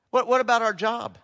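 ordinary talk, nothing marked

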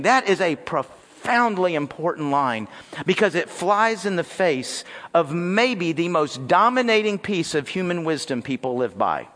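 ordinary talk

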